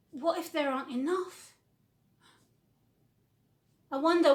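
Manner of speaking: ordinary delivery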